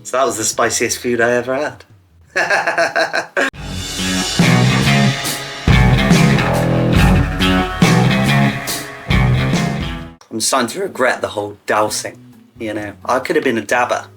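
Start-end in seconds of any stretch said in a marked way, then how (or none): 2.3-10.1 long laugh, pitch dropping low; hearty laugh. Real, not acted. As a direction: laugh it off after the story. Laughing at spicy ordeal story.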